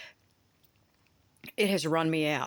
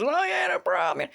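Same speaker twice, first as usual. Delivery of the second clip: mock carefree; 11 semitones higher than usual. Mocks the sub crew's attitude.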